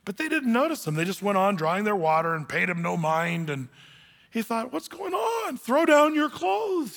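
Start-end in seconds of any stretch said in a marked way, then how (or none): 4.7-6.9 much higher, indignant donkey voice; the proud donkey crossly demanding attention. Acted. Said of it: "he said crossly".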